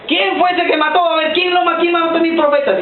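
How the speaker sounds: high, demanding mock fury; angry queen. Jezebel demanding who killed her prophets, high mock anger.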